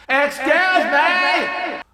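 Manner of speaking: high, drawn-out screech; practically screaming. Retold or imitated. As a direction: screech it slowly to get them moving. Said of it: "I'm, like, practically screaming".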